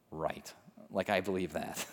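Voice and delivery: low, soft scoffing aside; dry disbelief. Acted. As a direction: drop your voice in quiet disbelief. Scoffing aside after quoted taunt.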